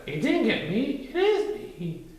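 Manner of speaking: high squeaky piglet; a small sure no. A narrator's reading. Piglet answering from hiding, high and small.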